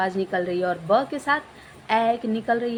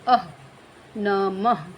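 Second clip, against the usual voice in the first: ordinary talk, nothing marked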